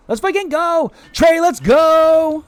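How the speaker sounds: high, drawn-out celebratory shout; hyped greeting. Real, not acted. Streamer cheering Trey's arrival in chat, hyped shout. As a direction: holler it high, stretching the last word.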